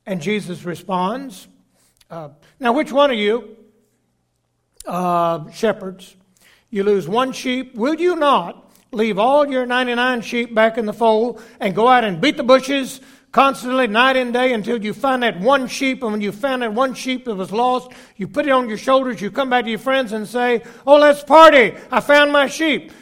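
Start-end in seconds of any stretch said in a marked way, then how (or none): 2.6-20.6 loud, higher, rousing pulpit voice; full-force preaching. Acted. Preacher challenging the congregation about the lost sheep, rousing and loud. 20.9-22.9 much louder and higher, bursting with joy; jubilant shout. Acted. Shepherd calling friends to celebrate the found sheep, jubilant shout.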